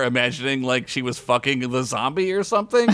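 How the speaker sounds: ordinary talk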